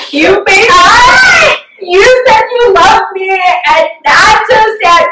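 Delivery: high-pitched, hammed-up blubbering; imitated sobbing, words stretched out high; tearful mock wail. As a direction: wail it like a weepy child to mom. Retells calling mom while crying.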